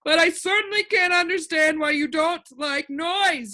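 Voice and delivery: high, lament for his grandfather; high creature voice, sorrowful; the din, grieving. A narrator's reading. Din's voice.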